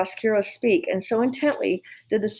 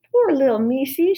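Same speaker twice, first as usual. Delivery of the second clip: put-on higher, simple girl's pity; pitying Mig; 6 semitones higher, 4 dB louder than usual. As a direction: coo over the little mouse. Mig pities mouse.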